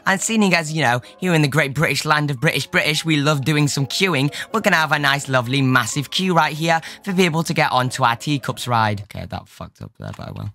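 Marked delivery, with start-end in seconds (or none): 0.0-9.0 hammed-up British accent, chatty host; mock British accent. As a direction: put on an over-the-top British accent. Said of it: "I can't do this accent".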